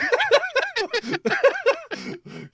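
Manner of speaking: big high laugh; loud high laughter. Real, not acted. Dad cracking up telling the waitress story, loud high laugh. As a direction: break up laughing hard.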